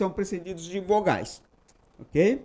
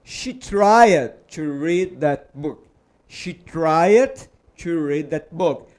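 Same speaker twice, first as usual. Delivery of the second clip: slow model sentence; 3 semitones lower, 4 dB louder, much slower than usual. Shown as a demonstration.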